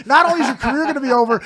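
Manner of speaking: retold high, loud, rushed warning; frantic warning. Comic warning his friend off Farrah, frantic.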